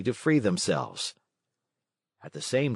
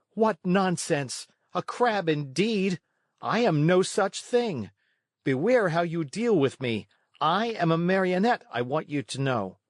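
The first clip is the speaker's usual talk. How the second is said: a resentful little marionette, raised pitch; high, indignant puppet voice; 8 semitones higher than usual. A narrator's reading. Pinocchio insulted at being called a crab, high and indignant. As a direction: protest hotly, offended pride. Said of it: "said resentfully".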